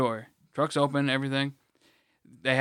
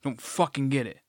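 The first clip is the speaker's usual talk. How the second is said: normal talking voice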